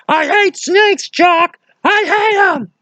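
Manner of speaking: Indy wails at Jock about the snake, hammed-up high squeal; mock terror. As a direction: wail your hatred of snakes. Acting Indy's snake fear.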